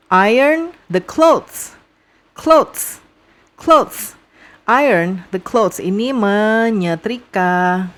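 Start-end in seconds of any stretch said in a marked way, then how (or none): none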